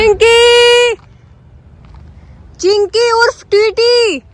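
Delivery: high call across the street. Real, not acted. Vlogger calls out to the stray puppy, high call. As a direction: call the dog by name. Calling the dog.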